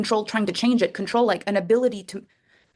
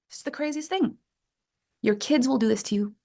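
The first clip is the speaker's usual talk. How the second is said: usual speaking voice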